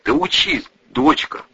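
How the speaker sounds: father's voice catching with emotion; wavering voice. A narrator's reading. Father urges daughter to study, voice wavering. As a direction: let the voice falter a little. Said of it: "Голос Олега Петровича дрогнул".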